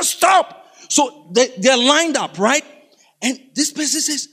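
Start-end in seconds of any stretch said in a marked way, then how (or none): 0.0-0.7 high-pitched cry of stop; dramatic interrupting shout; acted outburst. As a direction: break in abruptly, high. Next skit character interrupts routine.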